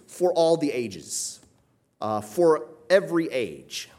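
high, loud and slow, stressing "all" and "every"; emphatic. Shown as a demonstration. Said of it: "what they would say is".

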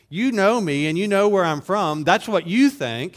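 loud and high, mocking their claim; ironic rebuke. Preacher voicing Jesus answering the crowd, loud and ironic. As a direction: throw their own words back at them.

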